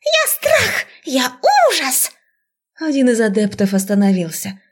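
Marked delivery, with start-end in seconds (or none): 0.0-2.1 squealing rat. A narrator's reading. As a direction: squeak out threats like a cartoon rat. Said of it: "верещала вторая".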